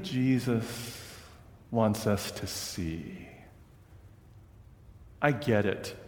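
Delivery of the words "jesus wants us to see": slow soft refrain. Acted. Sermon refrain slowed.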